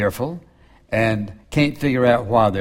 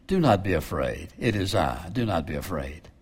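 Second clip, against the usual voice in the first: soft, hushed and calming, fading softer; gentle reassurance; 6 semitones lower, 7 dB quieter than usual. Retold or imitated. Jesus to disciples on the water, soft reassuring hush. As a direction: soothe frightened friends.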